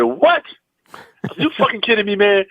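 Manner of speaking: high, stunned question; retold disbelief. Comic hears from his agent he got the job, incredulous. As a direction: replay the shock, pitch jumping up.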